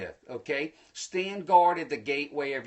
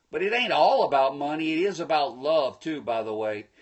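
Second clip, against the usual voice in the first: usual speaking voice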